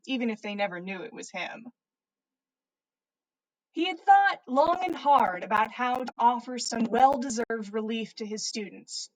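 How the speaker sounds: plain reading voice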